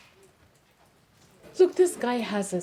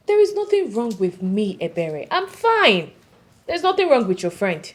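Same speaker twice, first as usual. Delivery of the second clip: loud, raised-voice denial; indignant protest; 8 dB louder than usual. Acted. Defends herself to teasing friend.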